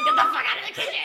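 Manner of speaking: very high, loud squeaked Kermit shout; squeaky Kermit yell. Retold or imitated. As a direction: scream it in a strangled frog voice. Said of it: "he's just like".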